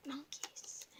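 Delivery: soft breathy whisper; random whisper. Acted. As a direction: breathe the word as a secret.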